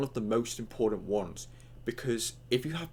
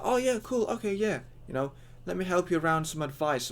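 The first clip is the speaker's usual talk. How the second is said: much higher, eager and upbeat; friendly enthusiastic; 8 semitones higher than usual. Retold or imitated. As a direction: sound genuinely glad to help. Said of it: "go like".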